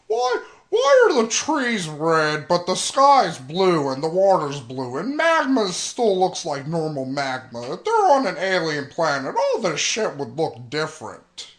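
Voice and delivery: mock-outraged nitpick with a laugh; nitpicking complaint. Fan imitating his own nitpicking after the movie. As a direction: whine about the red trees. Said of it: "I was like".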